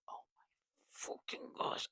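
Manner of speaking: barely audible panicked whisper. Retold or imitated. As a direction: mouth it in a hushed, voiceless panic. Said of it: "I'm, like, panicking".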